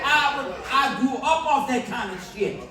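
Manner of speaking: ordinary delivery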